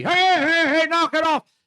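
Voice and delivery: high, loud shouted order; bailiff yelling. Retold or imitated. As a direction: shout them down like a courtroom bailiff. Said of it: "bailiff's yelling at me".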